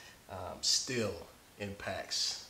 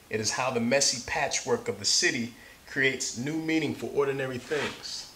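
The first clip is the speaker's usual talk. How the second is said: story narration; 2 semitones higher, 6 dB louder than usual. Acted. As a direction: read it like to a class of kids. Reads picture book aloud.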